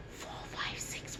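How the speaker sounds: soft unvoiced whisper into the ear. Shown as a demonstration. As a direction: whisper the numbers close to the ear. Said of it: "I'm going to whisper in your right ear".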